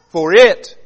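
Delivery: "for it" with it punched hard and loud; the word "it" hammered home; stress on it. Shown as a demonstration. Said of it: "look at the word it there".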